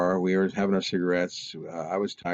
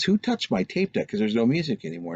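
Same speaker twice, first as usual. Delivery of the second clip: high, indignant demand; accusing; 8 semitones higher than usual. Retold or imitated. Brother demands who touched his tape deck, indignant.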